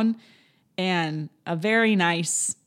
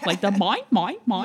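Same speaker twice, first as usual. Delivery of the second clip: pelican imitation; 3 semitones higher, 3 dB louder than usual. Shown as a demonstration. Mocking her own Australian accent, bird-like mine. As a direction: chirp it like a greedy bird. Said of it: "sound like the pelican in Finding Nemo".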